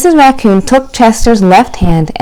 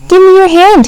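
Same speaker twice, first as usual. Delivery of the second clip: put-on much higher, louder eager kid; eager request; 9 semitones higher, 4 dB louder than usual. A narrator's reading. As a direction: ask with a sudden grin. Child grins at mother.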